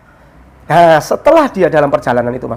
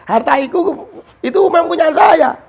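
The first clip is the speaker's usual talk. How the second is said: cool robber voice; 10 semitones higher than usual. Retold or imitated. Robber tells merchant he wants his life. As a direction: lay claim to his life.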